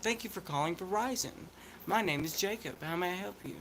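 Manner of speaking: polite accent-free operator greeting; no country accent. Shown as a demonstration. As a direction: put on accent-free phone customer service. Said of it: "work to make my voice sound like it".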